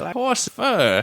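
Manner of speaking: high, slow quavering old lady imitation; old woman voice. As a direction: mimic the crazy old woman.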